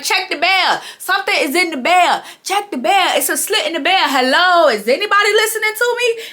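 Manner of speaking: high, insistent pleading call; trying to get anyone to check the bell; mock exasperated "hello, is anybody listening"; calling out again. [name] ignored, calls out again.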